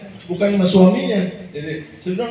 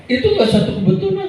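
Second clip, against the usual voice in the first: much higher, fast burst; emphatic exclaim; 13 semitones higher, faster than usual. Real, not acted. Preacher insisting to congregation it was no coincidence, high fast exclaim. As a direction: fire it at them.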